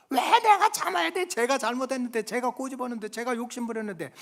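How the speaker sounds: high-pitched put-on kid's protest; whining child. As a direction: plead the unfairness like an aggrieved child. Child protests being blamed, acted. (억울해서 징징대는 아이 목소리)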